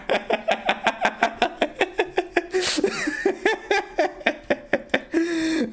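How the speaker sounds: helpless high-pitched laughing; long high laughter. Real, not acted. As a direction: keep laughing hard, real. Long laugh at robot joke.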